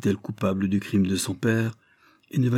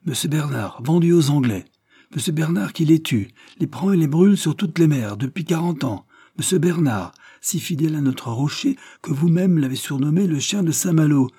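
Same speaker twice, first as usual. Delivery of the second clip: higher, louder indignant protest; indignant outburst; 8 semitones higher, 5 dB louder than usual. A narrator's reading. René defending Bernard before the mob, loud indignation. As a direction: defend him with outrage.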